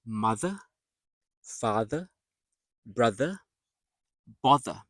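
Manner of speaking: "mother, father, brother, bother" word by word with voiced th in the middle. Shown as a demonstration. Teacher listing middle-TH words, careful articulation. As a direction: articulate each middle th separately. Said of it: "in the middle of the words".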